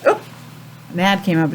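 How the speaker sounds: ordinary talk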